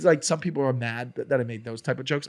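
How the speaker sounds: ordinary delivery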